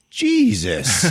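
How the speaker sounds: high, loud retold gasp of a word; hammed-up disbelief; mock shocked. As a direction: recoil in disgust. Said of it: "everybody else in the room is like".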